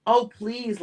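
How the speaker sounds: snapped. A narrator's reading. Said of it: "Lamont snapped".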